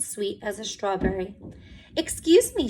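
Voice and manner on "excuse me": high, bright polite opener; bright greeting. A narrator's reading. Kind old woman greeting Mister Cream at the door, bright. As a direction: chirp it cheerfully. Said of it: "she said brightly".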